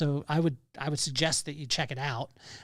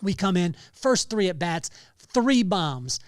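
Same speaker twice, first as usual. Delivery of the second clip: usual speaking voice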